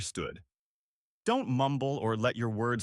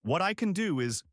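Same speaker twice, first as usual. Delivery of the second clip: positive phrasing; 6 semitones higher than usual. Shown as a demonstration.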